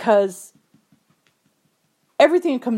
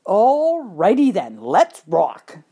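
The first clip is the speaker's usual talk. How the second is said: slow, drawn-out swooping "alrighty then"; game agreement; 4 dB louder, much slower than usual. Retold or imitated. Trainer agrees to her cowboy dream, game and drawn-out. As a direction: stretch it out, pitch swinging up then down.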